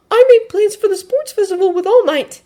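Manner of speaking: very high, loud character voice; determined Midoriya. Acted. Midoriya telling his friends about plans, high and determined. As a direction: announce it in a high, urgent voice.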